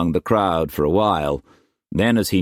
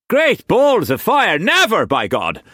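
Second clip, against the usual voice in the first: high, loud outburst; exploding; 5 dB louder than usual. A narrator's reading. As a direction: explode in outrage. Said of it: "Quantz exploded".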